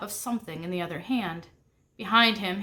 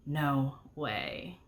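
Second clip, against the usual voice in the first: softer and lower, half on breath; awed, barely voiced no way; a hushed breath of disbelief; 6 semitones lower, 6 dB quieter than usual. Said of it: "I breathed".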